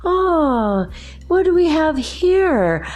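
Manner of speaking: put-on high, slow sing-song baby talk; mock patronizing voice for a child; talking down to a kid. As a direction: coo at them like a toddler. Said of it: "it's like you're like a little kid".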